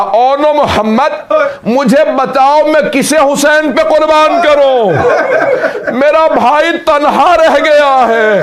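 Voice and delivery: high, slow, sobbing words of a grieving mother; weeping lament. Acted. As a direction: mourn over the son, crying and slow. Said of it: "रो के कहा". (रोते हुए धीमा ऊँचा विलाप)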